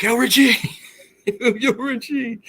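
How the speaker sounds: high-pitched mock yell to a buddy; retold yelling. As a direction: call out high, drawn out at the end. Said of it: "I yell".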